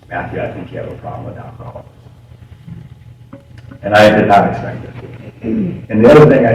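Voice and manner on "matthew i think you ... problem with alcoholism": near-whispered, fast and soft; hushed, calm words into the phone; very quietly. Retold or imitated. Brother quietly telling Matthew about his drinking, hushed. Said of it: "he just said very quietly into the phone".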